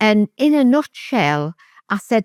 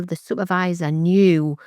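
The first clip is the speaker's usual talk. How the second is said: usual speaking voice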